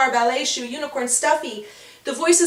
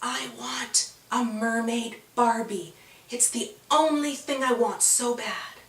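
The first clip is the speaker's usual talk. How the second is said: softer, slow, faraway wishing voice; soft whispery voice; 5 dB quieter than usual. A narrator's reading. Mysterious voice whispers a wish in Winter's ear, soft and slow. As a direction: breathe the wish like a secret. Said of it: "like a whisper in her ear".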